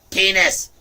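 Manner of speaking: sudden high, loud yell; yelled word. Real, not acted. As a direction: blurt it out loud to throw everyone off. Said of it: "if I just yelled that word".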